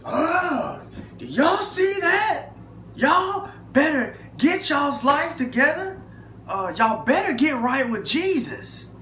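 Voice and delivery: a shaken cop preaching at the inmates; storming warning. Retold or imitated. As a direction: warn them all with big alarm. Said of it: "storms in the dorm".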